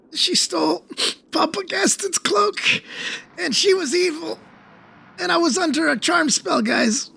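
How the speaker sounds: high, near tears complaint; upset whining. Acted. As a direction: wail it out to the party. Aster upset, high pitched.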